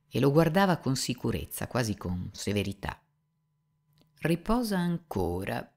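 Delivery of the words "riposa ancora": slow, unhurried reply. Acted. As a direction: take your time, no rush at all. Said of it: "disse senza fretta". (lento, senza fretta)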